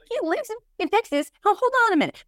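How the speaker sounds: a touch of sarcastic surprise; mock disbelief. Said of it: "which is like".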